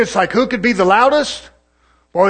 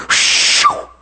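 breathy mock Holy Ghost shoo; mock spirit blast; 5 dB louder than usual. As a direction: push out a sharp shoo.

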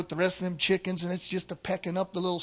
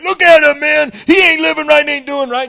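high, very loud accusing outburst; judgmental shout; 8 semitones higher, 14 dB louder than usual. Acted. Self-righteous churchgoer pointing out the wretched guy, judgmental shout. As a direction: shout it in outraged condemnation.

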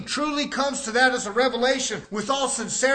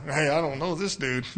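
furtive denial; 7 semitones lower than usual. Acted. Acting Peter denying Jesus.

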